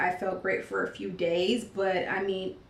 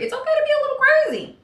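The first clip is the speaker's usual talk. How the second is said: high, fast, loud silly voice; mock crazy; 7 dB louder, faster than usual. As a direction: go up high and playful, acting a little crazy.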